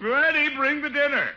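loud high call to the kitchen. Acted. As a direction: shout for the dinner to come. Calling to the cook.

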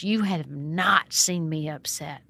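a touch of a threat, drawn out slowly; aggressive. Retold or imitated. Woman in the house warning the founder, mildly menacing. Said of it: "answered back in a really aggressive voice".

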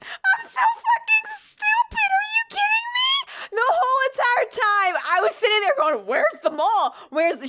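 squeaky, very high hammed-up whine; mock near-tears. Storyteller replays feeling stupid in the car, mock tearful whine. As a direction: wail it in comic despair, easing down by the end. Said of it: "borderline almost started crying in the car".

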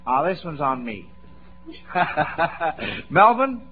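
ordinary talk, nothing marked

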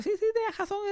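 high, soft mock concession; grudging admission. Partner admits it, in a high mocking squeak. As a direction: squeak out that he was right. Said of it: "Y ella como que".

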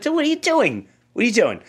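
scared son calling to his dreaming father; alarmed. Retold or imitated.

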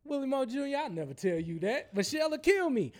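a hint of a joking statesman; Barack Obama. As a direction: imitate a president's playful reply. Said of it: "And he said".

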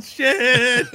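high mock yell of a curse; yelled curse retold. GM retelling the floating heart's curse, a high mock yell. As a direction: echo the player's swear, playfully. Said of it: "and yelled".

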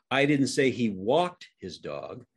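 walked lifted and held, slow sentence; single out the word walked; stress on WALKED. Shown as a demonstration. Demonstrating how tone changes the sentence, stress on walked. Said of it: "show you how this works just by using tone".

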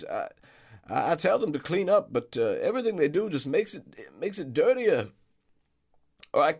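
put-on high, loud, harried old man; flustered groundskeeper. Groundskeeper apologising for the mess, flustered high voice. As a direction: apologise then call the kids down.

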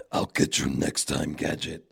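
deep sneering cartoon villain threat. Host showing his Inspector Gadget villain voice. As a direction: growl it low like a cartoon bad guy. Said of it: "That's the, from, uh, Inspector Gadget".

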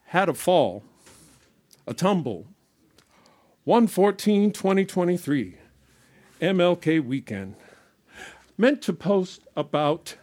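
rhythmic poetry reading; spoken word poem. Acted. Performer reading her poem about the embrace, spoken word. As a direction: recite it as a performance piece.

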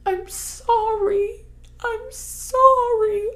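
very high, slow, crying plea; imitating a sobbing child. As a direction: plead to the stars like a heartbroken small boy. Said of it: "he goes".

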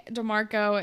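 ordinary talk, nothing marked

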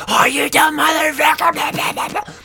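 very high, loud mock shriek; imitating changed attitude. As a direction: mimic him screeching insults into blah-blah. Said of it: "his attitude, the way it changed".

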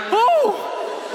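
high-pitched startled "oh"; acted shock, pitch leaping up; alarmed gasp. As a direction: yelp as if the floor dropped away. Watching friends drop from plane.

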